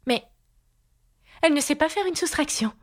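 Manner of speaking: high-pitched verdict. A narrator's reading. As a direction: declare it in a high queenly voice.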